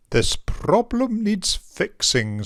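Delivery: a touch of a cross mayor scolding; frowning mayor. Acted. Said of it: "said the mayor with a frown".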